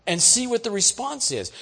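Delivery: ordinary delivery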